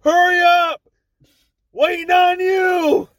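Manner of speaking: high, loud chant, last words slowed; group shouting. Retold or imitated. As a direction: bellow it at the latrine door. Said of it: "all I hear is".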